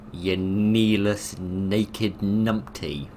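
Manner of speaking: slow, drawn-out scornful jeer; sneering put-down, words stretched; mock insult. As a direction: savour each insult slowly. Insulting an enemy character.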